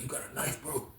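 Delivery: breathy unvoiced whisper. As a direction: mouth the question secretly. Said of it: "why you talking like that".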